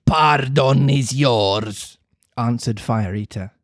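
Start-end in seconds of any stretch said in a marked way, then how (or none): 0.0-2.0 put-on embrace the boy gruffly; slow puppet master offering a hug; softened villain. Fire Eater grants pardon.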